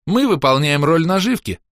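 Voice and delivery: high-pitched quick rejoinder; parry the objection; parrying retort. A narrator's reading. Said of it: "парировала чёрная баронесса". (парируя, высоким голосом)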